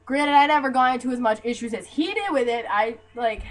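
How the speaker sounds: usual speaking voice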